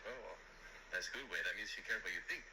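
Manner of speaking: lightly imitated Mexican English accent; a coworker voiced with a slight Mexican accent. Coworker reassures the waiter about the guest, light Mexican accent. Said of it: "that's my Mexican accent".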